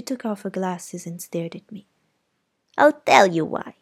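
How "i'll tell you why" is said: louder, hard aunt; cold anger. Acted. Aunt turning on Scout, louder and hard. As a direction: make her bristle. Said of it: "she said".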